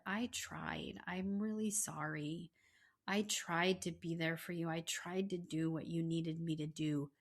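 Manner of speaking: soft, subdued imitation of a tearful man; hushed mock-tearful plea; retold tearful apology. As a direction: drop the volume, apologize for him sorrowfully. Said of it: "he starts to cry".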